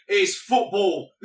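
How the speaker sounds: slow, loud, high shout with a laugh; retold shouted line. Police chief punching a man out, shouting. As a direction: bellow it slowly, half laughing. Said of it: "punching him out and saying".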